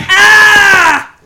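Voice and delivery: short, loud, high mock scream of pain. GM voicing the downed Topper's mock scream. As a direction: yell out like someone being pummelled. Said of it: "you've heard Topper go".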